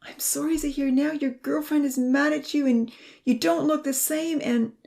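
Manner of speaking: high, distressed run-on apology; tearful, pleading, high voice; crying out. A narrator's reading. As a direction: pour out guilt until cut off. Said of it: "Ponzi cried".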